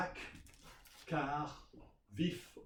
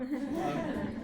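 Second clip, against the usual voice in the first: laughing; 12 semitones higher, 3 dB louder than usual. Real, not acted. Laughs at running out of time.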